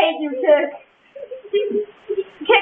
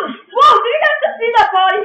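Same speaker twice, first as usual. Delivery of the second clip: high, loud excited reaction; amazed outburst; 8 semitones higher, 5 dB louder than usual. Real, not acted. Gamer pointing out a wild death to friends, excited shout. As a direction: burst out in amazement, pitched high.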